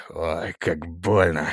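slow, low, soft moan; groaning in pain. Acted. Prince nurses his bumped forehead, moaning in pain. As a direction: sink into a drawn-out pained groan.

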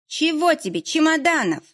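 a put-upon caretaker, annoyed at being hailed; displeased woman's voice. A narrator's reading. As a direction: Zoya Ivanovna shouting up at Fedya, displeased. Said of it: "недовольный женский голос".

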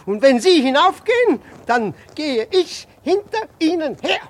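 loud, high defiant declaration; stubborn loud vow; defiant insistence. Acted. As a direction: stand your ground, loudly.

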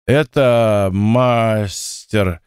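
put-on drunk slurring; slow tipsy slur; frat bro. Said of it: "заплетающимся языком продолжила девушка".

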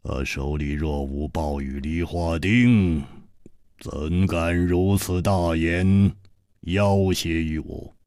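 低沉冰冷的反派嗓音，慢慢吐字; 压低声音，冷冰冰地威胁; 冷冷的黑袍客. A narrator's reading. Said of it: "黑袍客冷笑着说". (黑袍客对楚留香冷冷威胁，低沉阴冷)

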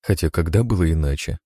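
ordinary delivery, nothing marked

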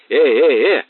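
loud, sharp yell to stop; shouting. A narrator's reading. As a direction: bellow to break it up. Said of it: "заорал Мазур". (громкий окрик)

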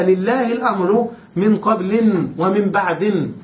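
قبل and بعد read as qablin, ba'din; genitive with tanween. Shown as a demonstration. Teacher quoting a rare reading, قبل and بعد ending in -in. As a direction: end both قبل and بعد in -in. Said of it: "من قبل بالتنوين ومن بعد".

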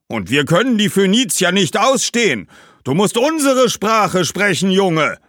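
high, loud ghostly scorn; scornful ghosts. A narrator's reading. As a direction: spit out the dislike of Phoenicians. Numidian ghosts scold the boy. (hoch und laut verächtliche Geister)

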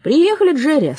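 loud cheerful orderly call; hearty announcing orderly. A narrator's reading. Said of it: "сказал санитар".

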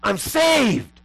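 very high, loud imitated outburst; overwhelmed yell. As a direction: burst out yelling, overcome with it. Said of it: "he'd just get overwhelmed with it".

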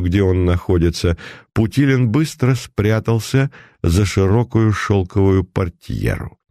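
usual speaking voice

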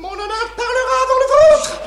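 high, loud defiance; defiant shout. Acted. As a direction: hurl the defiance back. Défi de la reine. (défi crié avec force)

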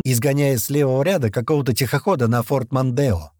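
plain reading voice

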